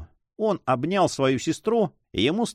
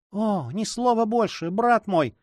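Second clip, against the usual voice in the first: cry out; 8 semitones higher, slower than usual. Acted. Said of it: "вскричала она".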